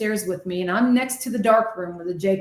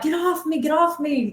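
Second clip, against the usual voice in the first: high, panicked repeating; frantic pleading; 7 semitones higher than usual. Retold or imitated. Medium begging the spirits crowding her, high frantic pleading. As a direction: push them away in desperation.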